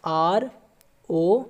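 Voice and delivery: very slow letters with long pauses; spelling out slowly. Shown as a demonstration. Teacher spelling a long word for students, very slow. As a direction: dictate each letter so they can follow. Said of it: "pronunciation को कैसे लिखिएगा आप लोग?".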